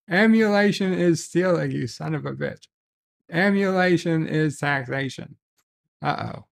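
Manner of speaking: ordinary delivery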